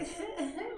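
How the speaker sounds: high airy giggle, mocking a carefree type; giggly mock laugh. Mocking a fun-seeking woman, giggly high laugh. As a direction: laugh like a flighty partygoer.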